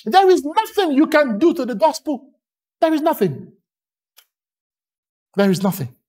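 usual speaking voice

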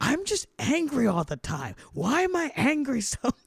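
exasperated, whiny "why am I angry"; exasperated complaint. Retold or imitated. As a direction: complain to the therapist, half-joking. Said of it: "I was like".

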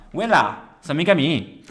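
loud Milanese dialect line; Milanese accent. Acted. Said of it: "Giuda fa".

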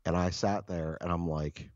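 ordinary talk

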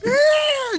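very high-pitched squealed cry; mock whimpering squeal. Friend imitating the girl they bully, mock whimpering squeal. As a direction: imitate her whimper as a piercing little shriek. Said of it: "She starts, like, whimpering".

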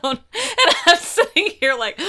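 talking through real laughter; laughing loudly while speaking. Real, not acted. Both laughing hard.

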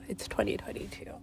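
a hushed whisper, barely voiced. Whispering a self-correction to herself about the year. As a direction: breathe the correction out as a secret whisper.

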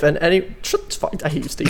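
usual speaking voice

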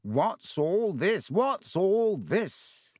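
high, slow, showy voice; grand Lockhart. A narrator's reading. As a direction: sweep in theatrically.

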